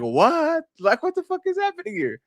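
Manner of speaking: high, incredulous what; mock shocked outburst; retold disbelief. Retelling reaction to comments calling Ali woke, high incredulous shock.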